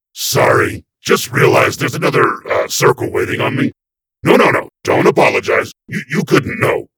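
loud nervous babbling excuse; flustered. A narrator's reading. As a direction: backpedal in a panic. Flustered demon.

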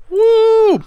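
high, loud whoop of joy; excited cheer. Real, not acted. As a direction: cheer the lucky roll. Cheering five heads.

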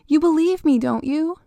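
high, pleading question; anxious child. A narrator's reading. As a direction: beg your mother for belief. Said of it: "Jamie stuttered".

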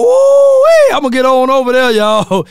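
high, loud "ooh-wee" into quick talk; hammed-up excited holler; mock excited whoop. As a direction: whoop like a man smitten at first sight. Acts out excited man spotting a lady.

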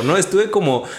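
ordinary talk

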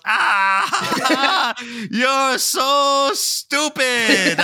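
loud, high, drawn-out jeer; hammed-up gleeful taunt with big laughs; mocking cackle. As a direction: imitate a dad cackling in his kid's face. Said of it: "his dad just cackles in his face".

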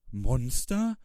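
high, loud startled cry; piglet's cry. A narrator's reading. Piglet to the owl about the danger, crying out. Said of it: "schrie Schweinchen".

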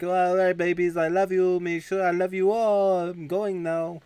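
high, loud crooning farewell; hammed-up lover-boy voice; ladies' man. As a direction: gush goodbye to all your girlfriends. Ladies' man bids girlfriends goodbye.

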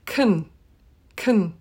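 kun with a short schwa vowel; swallowed weak form kun; reduced kun. Shown as a demonstration. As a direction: say kun, barely any vowel. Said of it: "or even kun".

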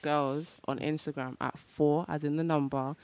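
low, soft seductive voice; sexy voice. Real, not acted. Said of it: "What is this sexy voice?".

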